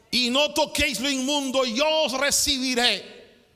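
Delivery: loud, high-pitched pulpit delivery; fiery preacher proclaiming; preaching at full force. Acted. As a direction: thunder the verse out to the congregation. Preaching the scripture aloud.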